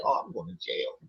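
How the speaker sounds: low, hushed mock panic to himself; a defendant muttering dread in court, low and hushed; scared aside. A defendant muttering dread in court, hushed mock panic. Defendant fears judge's reaction.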